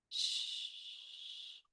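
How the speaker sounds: soft shush. Real, not acted. Teacher hushing students while they work, soft shush. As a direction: hush the room gently.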